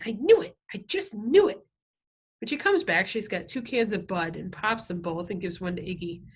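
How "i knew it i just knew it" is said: high, loud crowing; gleeful, laughing. A narrator's reading. Loretta calling from the kitchen, gleefully triumphant. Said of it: "we can hear her laughing and saying".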